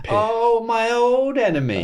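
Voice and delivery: high hammed-up greeting of an old enemy; mock villain greeting. Comedian greeting piss like an arch-nemesis, mock dramatic.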